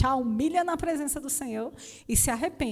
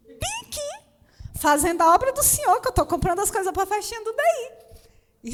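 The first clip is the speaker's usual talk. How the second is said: desperate plea; 9 semitones higher, 5 dB louder than usual. Retold or imitated. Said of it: "Clamei assim".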